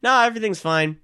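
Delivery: high, airy, casual mock cheer; breezy quitting voice. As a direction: toss off the news like it's nothing. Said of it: "Wolf of Wall Street style".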